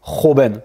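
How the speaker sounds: joven opening on a throaty kh, kho-ben; guttural Spanish J. Shown as a demonstration. Teacher showing the J, joven said kho-ben. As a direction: scrape the J of joven at the back of the throat. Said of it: "quelque chose de plus guttural".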